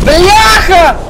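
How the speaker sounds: sharp shouted curse; startled yell. Real, not acted. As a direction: yelp out in shock. Sudden scare in game.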